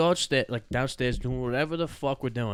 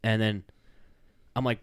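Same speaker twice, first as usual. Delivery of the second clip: ordinary talk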